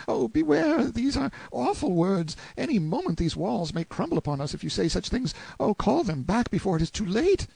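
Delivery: put-on very high, panicky boy's voice; frightened pleading. A narrator's reading. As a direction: beg in terror, pitched far up. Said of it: "like to go out of his mind with fright".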